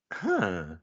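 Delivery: ordinary delivery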